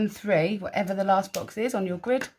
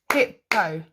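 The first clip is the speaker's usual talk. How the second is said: "Hippo" split into two separate beats; "Hippo" with a gap between the syllables. Shown as a demonstration. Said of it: "How many?".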